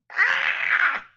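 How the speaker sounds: very high monster screech; magmin shriek. Acted. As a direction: screech like a startled little fire creature. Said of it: "they all kinda go".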